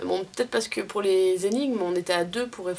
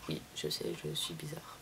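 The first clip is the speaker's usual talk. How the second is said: low unvoiced whisper, much softer; whispered aside; 14 dB quieter than usual. Real, not acted. Vlogger admitting to viewers she's weird, whispered aside. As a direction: confide it in a hushed whisper.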